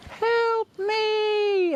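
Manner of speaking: very high squeaky cry for help; tiny squeaky cry. Retold or imitated. Friend on the phone pranking with a tiny high squeaky cry. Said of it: "and I'd hear, long pause".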